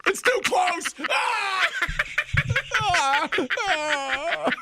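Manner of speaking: high yelping shouts; panicked yelling. Pretend. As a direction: yell in mock panic. Mock panic at camera.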